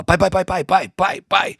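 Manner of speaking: put-on high, insistent childish calling; little son calling dad. Father imitating his son pestering him for attention, high childish repeated calling. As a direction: call for dad over and over like an eager little boy. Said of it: "ele fala".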